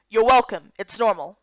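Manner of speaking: firm orders, no room to argue; stern Miko. Acted. Miko brushes off thanks.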